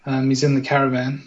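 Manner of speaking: low hushed live commentary; game commentator. Acted. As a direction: talk him up like a TV commentator. Mock commentary on contestants.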